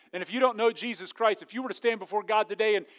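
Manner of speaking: ordinary delivery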